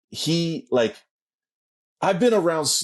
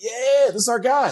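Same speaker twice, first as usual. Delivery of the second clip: hammed-up celebratory whoop, pitched way up; mock cheering; 9 semitones higher than usual. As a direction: imitate a fan hoisting the hero. Mimics celebrating, lifting up his friend.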